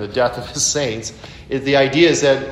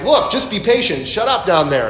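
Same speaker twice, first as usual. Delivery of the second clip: mock-impatient, pitched high; a touch of an exasperated God; 6 semitones higher than usual. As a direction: shush the complainers, half joking. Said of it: "if I were God, I might be like".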